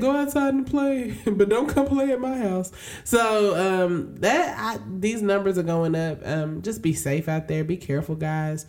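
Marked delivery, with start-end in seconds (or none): 0.0-2.7 high, laughing mock governor. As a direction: poke fun at him in a raised voice. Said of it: "He's like".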